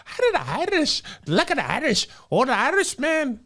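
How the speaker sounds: very high, hammed-up Irish accent. Shown as a demonstration. Showing co-hosts his Irish accent on request, high comic Irish. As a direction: squeal out a cartoonish Irish lilt. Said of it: "let me hear your Irish accent".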